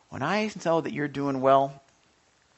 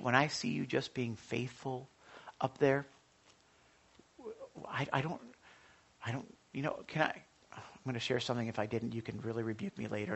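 normal talking voice

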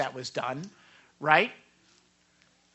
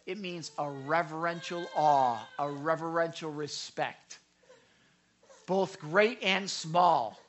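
ordinary delivery

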